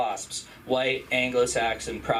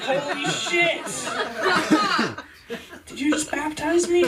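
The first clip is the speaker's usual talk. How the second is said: mock shock; 13 semitones higher than usual. Comic surfacing after surprise baptism, high mock shock. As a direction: act out stunned, strained disbelief.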